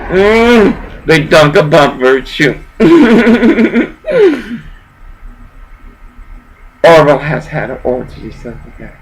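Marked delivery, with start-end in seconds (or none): 2.8-4.6 high, louder burst of laughter; a sudden high cackle. Real, not acted. Laugh alone on a line.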